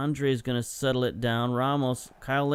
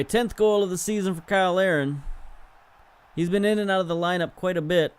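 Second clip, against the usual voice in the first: higher, faster, brighter announcement of the tally; upbeat; 5 semitones higher, faster than usual. Real, not acted. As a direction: hype up the milestone quickly. Celebrating goal tally.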